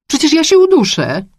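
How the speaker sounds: high, loud complaint; exasperated high-pitched outburst; distressed protest. A narrator's reading. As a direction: cry out for room to breathe. Suffocating woman protests in the crush.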